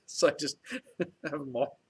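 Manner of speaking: real, amused laughter breaking up the words; laughing while talking. Real, not acted. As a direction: crack up at your own silly idea. Amused at own helmet idea.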